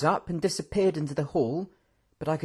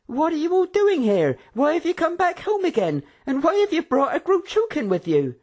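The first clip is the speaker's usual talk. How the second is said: bewildered hostess; 5 dB louder than usual. A narrator's reading. Karina questions husband.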